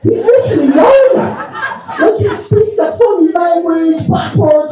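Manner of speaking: high laughing voice. Real, not acted. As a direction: laugh through the words. Laughing description of the president.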